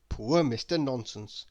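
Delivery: plain reading voice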